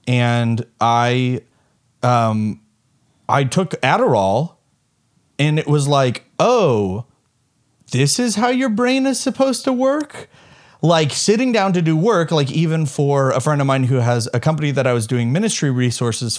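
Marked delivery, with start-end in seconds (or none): 6.4-10.3 amazed realization. Retold or imitated. Realizing on Adderall.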